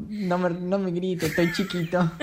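put-on slow, high, whimpery little-kid voice; little child voice. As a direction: plead like a tiny hurt child. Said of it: "con ganas de llorar". (niño chiquito lloriqueando, lento y agudo)

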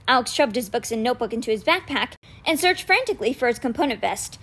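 usual speaking voice

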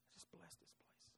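hushed whisper, almost to oneself; very soft, private whispered prayer; whispered blessing. Acted. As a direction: whisper a blessing under your breath. Same blessing repeated under breath.

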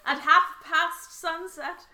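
high, slow, theatrical finish; mock old-fashioned letter-writer. Co-host completing the mock journal entry, mock old-fashioned. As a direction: join the joke with drawn-out grandeur.

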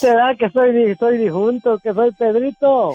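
lightly voiced old man; a hint of an elderly man. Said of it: "se le escucha la voz de viejito".

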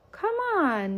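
urging his brother, lightly called out; shouted. A narrator's reading. Yessie calls to his older brother, a mild mock shout. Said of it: "Yessie shouted".

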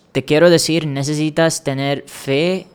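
normal talking voice

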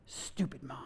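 low, very soft imitated mutter; child's under-breath muttering, imitated. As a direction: mutter it so nobody quite hears, like a sulky child. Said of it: "muttering something under your breath".

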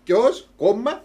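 high, loud indignant repeat of who; who, who, who, in mock outrage; outraged disbelief. Real, not acted.

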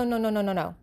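ordinary talk, nothing marked

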